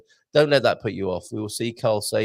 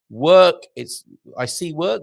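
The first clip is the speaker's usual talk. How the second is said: ordinary talk, nothing marked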